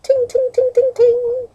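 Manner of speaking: very high, light ringing ting ting; high chiming bell imitation. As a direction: ring out like a little bell.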